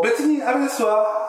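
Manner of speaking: high haughty desu-wa; lightly played rich girl; ojousama voice. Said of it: "お嬢様言葉だと".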